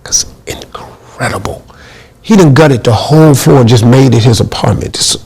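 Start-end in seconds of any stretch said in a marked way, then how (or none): 0.1-1.6 hushed, breathy awe at the place; a stunned whispered aside; awed whisper. Retold or imitated. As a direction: whisper it like you can't believe your eyes. Awed at Mike's gutted floor.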